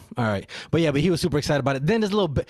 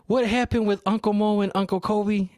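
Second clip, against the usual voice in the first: put-on child voice; 8 semitones higher than usual. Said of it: "Jorge goes".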